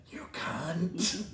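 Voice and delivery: soft, strangled, venomous whisper; seething whisper. Retold or imitated. Retelling what he held back from the lady, seething whisper. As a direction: insult squeezed out under the breath. Retold seething insult under breath.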